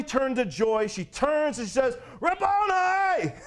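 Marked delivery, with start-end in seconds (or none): 2.2-3.3 high, drawn-out cry of recognition; joyful cry. Acted. Mary turns and recognises her risen teacher, joyful cry. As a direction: call it out long and high, overjoyed. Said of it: "the joy comes ushering in".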